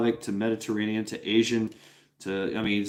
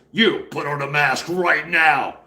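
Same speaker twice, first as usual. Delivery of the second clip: stern cop order; 4 dB louder, slower than usual. Retold or imitated.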